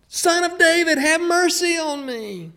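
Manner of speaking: high, loud, insistent plea. Retold or imitated. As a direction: cry out even harder. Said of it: "He just kept calling out more and more".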